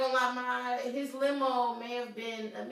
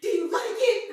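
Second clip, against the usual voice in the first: high, loud mock dirty talk; hammed-up, squeaky attempt at seduction; failed aggressive voice; 11 semitones higher, 9 dB louder than usual. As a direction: imitate a guy straining to sound aggressive. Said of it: "It's the way he said it".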